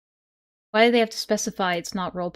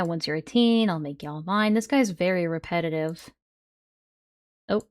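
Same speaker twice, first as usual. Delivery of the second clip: usual speaking voice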